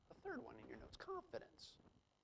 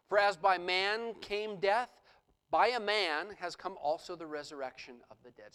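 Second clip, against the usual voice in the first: loud, emphatic preaching of the passage; scripture reading; 7 dB louder than usual. Acted. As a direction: proclaim it to the congregation. Quotes scripture again.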